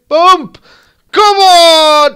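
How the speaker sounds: high, loud yell; triumphant shout. Real, not acted. Gamer roaring at a goal. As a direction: roar it at the screen.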